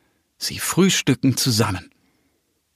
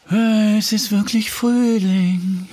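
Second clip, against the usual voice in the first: much higher, slow delighted hedgehog voice; joyful hedgehog; 10 semitones higher, slower than usual. Acted. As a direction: call it out with springtime delight. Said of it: "ruft er".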